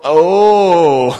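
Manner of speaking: loud sheepish oh into a laugh. Retold or imitated. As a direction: let it collapse into an embarrassed laugh. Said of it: "And really sheepishly".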